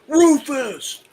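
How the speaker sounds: high, loud call-out; yelled catchphrase. Acted. Fan blurting out a film line at seeing the actor, yelled. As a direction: yell the name in a big high voice. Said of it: "not quietly yell".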